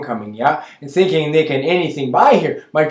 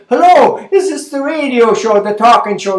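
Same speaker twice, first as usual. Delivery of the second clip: high, loud put-on Mennonite accent for the radio phone-in; bright, raised hello in a Mennonite accent, calling in to the show; [name]'s phone voice; 6 semitones higher, 4 dB louder than usual. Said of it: "this is how I am speaking".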